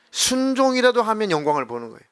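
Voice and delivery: normal talking voice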